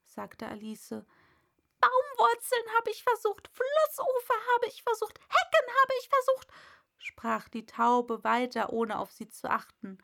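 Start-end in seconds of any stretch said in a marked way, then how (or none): 1.8-6.5 high, loud bird voice, ranting; complaining pigeon. A narrator's reading. Pigeon ranting to Alice, high and loud. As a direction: rattle off the grievances, shrill bird.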